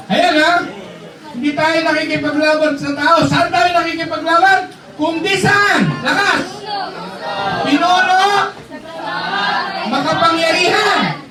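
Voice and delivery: fiery preaching. Acted. Preacher rousing the youth about darkness, high shouted fervor. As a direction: preach it hard to the back row.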